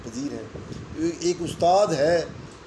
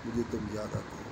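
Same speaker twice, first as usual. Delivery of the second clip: much softer, lower, dying away; soft fading repeat; 5 semitones lower, 9 dB quieter than usual. Acted. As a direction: let the last line fade to a hush. Repeated closing line fades.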